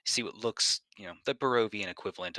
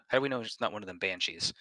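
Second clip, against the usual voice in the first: ordinary talk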